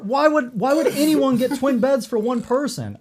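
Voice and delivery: loud, high outraged yelling; angry. Retold or imitated. Retold outraged question to hotel owner.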